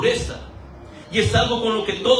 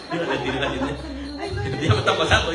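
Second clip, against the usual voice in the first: laughing; much slower than usual. Real, not acted. Joking aside, laughing.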